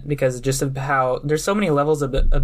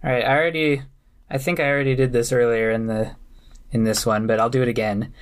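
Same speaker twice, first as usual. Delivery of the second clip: usual speaking voice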